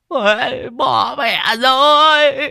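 very high, loud, drawn-out howling; anguished wail. Acted. Bloodied old man curses his friend, wailing. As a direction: bawl each cry out long.

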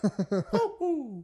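high falsetto plumber whoop; Mario. Acted. Host showing the character's catchphrase, high whoop. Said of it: "you gotta do the hoo".

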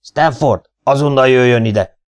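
loud barked order into the mike; bellowing. A narrator's reading. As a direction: roar the command down the intercom. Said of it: "belehördült". (ordítva a mikrofonba)

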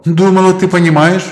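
high light female voice; Мэлла's voice. Acted. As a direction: voice inside his head. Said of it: "голос Мэллы".

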